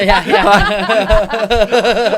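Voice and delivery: high, loud laughing yeah yeah. Real, not acted. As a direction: laugh the words out.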